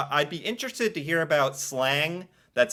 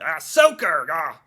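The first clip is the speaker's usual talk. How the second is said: Soaker shouted louder as a yelp of dismay; loud mock yelp of soaker; exclaimed soaker; 7 dB louder than usual. Shown as a demonstration. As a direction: cry out soaker as your foot hits the puddle. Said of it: "feels good to say it".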